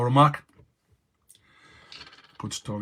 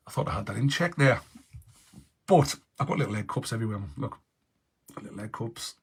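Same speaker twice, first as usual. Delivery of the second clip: ordinary delivery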